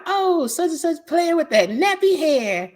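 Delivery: very high, drawn-out mimicry.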